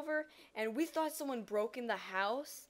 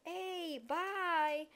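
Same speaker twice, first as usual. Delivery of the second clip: higher-pitched imitated farewell; family calling goodbye; 7 semitones higher than usual. Family heading out the door for church, imitated higher-pitched goodbye. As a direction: call a casual goodbye in a raised pitch. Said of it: "I hear my family walking out the door".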